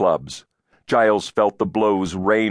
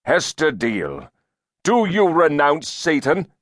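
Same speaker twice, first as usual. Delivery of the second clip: high-pitched formal demand; stern inquisitor; 8 semitones higher, 3 dB louder than usual. A narrator's reading. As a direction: demand a renunciation from the accused. Elder demands public renunciation.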